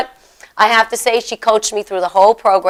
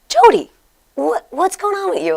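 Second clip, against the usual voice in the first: high, startled "Jody" then a hushed question; surprised to cousin; 7 semitones higher, 4 dB quieter than usual. Retold or imitated. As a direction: gasp at how different she looks. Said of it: "and I said".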